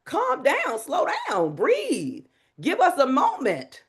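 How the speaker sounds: high, exasperated audience pleading; annoyed listener telling speaker to calm down; protesting audience member. Retold or imitated. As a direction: beg the speaker to slow down. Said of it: "didn't you say you wanted us to repeat".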